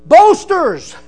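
loud, high pulpit shout; shouted denunciation. Acted. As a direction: cry it out at the crowd. Preacher denounces boasters.

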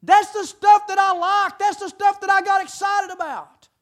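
loud, high-pitched fiery preaching; full-force preaching. Real, not acted. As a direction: belt it out to the congregation, drawing out the words.

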